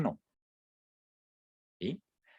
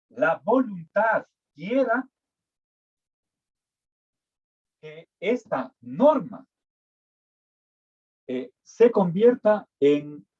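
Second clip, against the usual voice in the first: slow and deliberate, stressing the ú of Actúa; slow dictation; 4 semitones higher than usual. Shown as a demonstration. Teacher dictating the rule to her class, slow and deliberate. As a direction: dictate it phrase by phrase, slowly. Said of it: "Actúa debe ser con tilde en la U".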